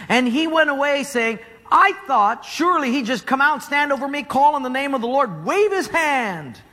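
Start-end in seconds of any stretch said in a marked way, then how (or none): 1.7-6.6 Naaman ranting in a raised, higher voice; furious. Acted. A proud general furious at the prophet's order, ranting higher. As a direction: let the outrage climb as he complains. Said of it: "he was furious".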